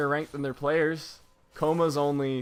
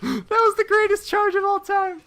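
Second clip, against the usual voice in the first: climbing from a loud call to a much higher, excited shriek; rising hype; 8 dB louder than usual. Real, not acted. Caster watching a crazy charge, building excited shout. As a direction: get more and more thrilled as the charge lands.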